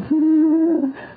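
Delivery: a high, loud villain's laugh; sinister laugh. Acted. Schoolmaster gloating over drugged Watson, loud sinister laugh. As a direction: gloat with a sharp evil laugh.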